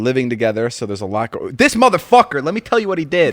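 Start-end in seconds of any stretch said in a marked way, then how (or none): 1.5-3.3 outraged complaint. Real, not acted. Outraged complaint about roommate.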